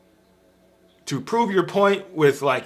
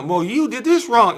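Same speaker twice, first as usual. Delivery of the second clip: higher, louder accusing snap in play; hammed-up finger-pointing quarrel; mock accusation; 8 semitones higher, 4 dB louder than usual. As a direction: fling blame back in a mock argument. Acting out a quarrel.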